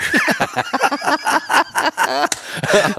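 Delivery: a big, high burst of laughter; bright, hearty laugh. Real, not acted. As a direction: laugh out loud at the punchline. Laughing at the caddie's joke.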